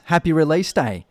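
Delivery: ordinary talk, nothing marked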